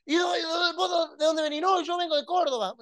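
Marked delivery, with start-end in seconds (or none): 0.1-1.6 high, stumbling reporter question; stammering mock reporter. Preacher imitating a reporter stammering at a fan, high voice. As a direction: trip over the question excitedly.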